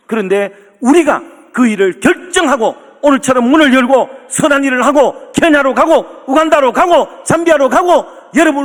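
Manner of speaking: high, driving pulpit cadence; rally the congregation phrase by phrase; full-voiced preaching. Builds a preaching climax. (목청 높여 힘차게 선포하는 설교)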